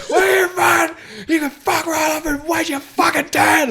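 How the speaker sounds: high, loud imitated tirade; retold yelling. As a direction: yell like an incensed driver at the pump. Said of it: "who's yelling at him".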